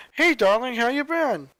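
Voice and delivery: a lightly voiced excited old dad; old father happy to hear his daughter, mildly excited. A narrator's reading. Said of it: "asked in excitement".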